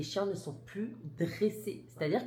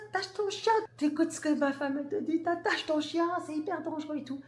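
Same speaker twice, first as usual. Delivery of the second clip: much higher, hammered-out angry yell; retold furious yell; 10 semitones higher than usual. Husband yelling at the owner to leash his dog, a furious high yell. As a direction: bark the order at him with everything you've got, high and hard. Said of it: "il lui a crié dessus. Mais de toutes ses forces".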